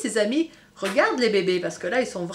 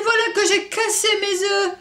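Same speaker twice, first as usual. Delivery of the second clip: higher, louder distressed exclamation; panicked cry; 10 semitones higher, 7 dB louder than usual. Acted. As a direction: wail over the broken eggs. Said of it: "s'écria-t-elle". (exclamation paniquée, plus aiguë et plus forte)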